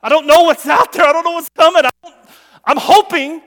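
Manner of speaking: high, loud, mounting doubt; urgent outcry. Acted. Preacher voices a doubter who can't see ahead, crying out. Voicing an anxious believer's faith moment.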